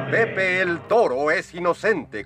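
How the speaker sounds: announcer. Acted. Show title announced.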